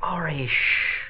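slow, soft hush to a friend; hushed shush. Acted. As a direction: quietly shush her to stop talking. Shushing friend to keep quiet.

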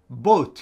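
bought said as bot with no gh sound; teacher modelling bought; pronouncing bought. Shown as a demonstration. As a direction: model the word bought with a clean long o. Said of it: "発音しないジーエイチが入ってね".

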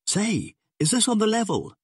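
very high, alarmed question; startled, anxious high voice; badly rattled. A narrator's reading. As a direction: ask in shocked disbelief, pitch leaping up. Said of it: "he breathed, badly rattled for once".

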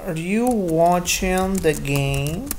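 slow, drawn-out "Are you watching the game?"; slow model sentence. Shown as a demonstration. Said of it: "y le digamos I N G".

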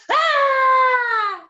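long, high, loud "Ah!"; demonstrated yell. Shown as a demonstration. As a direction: yell it out big and sustained.

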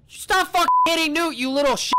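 loud, high furious scolding; angry yell. Real, not acted. Yelling at NPC hitting Newt.

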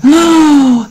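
high, wondering oh; light up at the sight; delighted gasp. A narrator's reading. Goat seeing the lettuces, high and delighted. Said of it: "said the next one".

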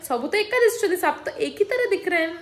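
high teasing imitation of father; light parody of a puzzled father; mocking dad. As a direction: mimic dad saying they all look alike. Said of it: "फिर पापा बोलते हैं".

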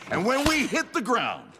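cocky hype. Acted. Fighter challenges the legends in an interview, swaggering. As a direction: pitch leaping up in a showman's boast.

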